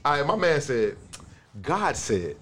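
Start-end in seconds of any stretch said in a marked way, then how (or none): 1.6-2.4 low, measured solemn recital; dramatic reading. Acted. Reading a friend's post to the group in a low, solemn voice.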